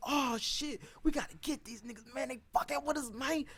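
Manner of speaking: high, excited mock rally cry; teen crowd fired up, high pitched; hyped-up classmates. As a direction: whip up the crew to fight back.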